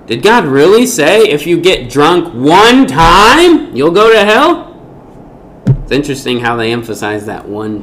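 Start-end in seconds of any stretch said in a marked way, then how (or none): 0.1-4.7 higher, louder, slow taunting question; mocking tempter. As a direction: drag it out to sneer at the rule. Voicing Satan's mocking suggestion.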